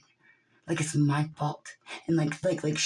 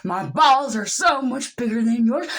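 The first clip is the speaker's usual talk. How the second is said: taunt; 5 semitones higher, 6 dB louder, slower than usual. Real, not acted. Taunting the powerful.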